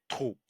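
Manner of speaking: trou with an ou sound; word trou. Shown as a demonstration. Teacher giving trou as an example. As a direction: say trou clearly. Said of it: "O U, ça fait".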